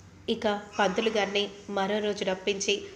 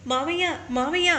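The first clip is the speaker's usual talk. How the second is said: calling out higher for his uncle; loud calling; 7 semitones higher than usual. Acted. Son calling his uncle for help, calling out loudly. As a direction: call out urgently to uncle, higher pitch. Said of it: "గట్టిగా దీపక్ పిలుస్తూ".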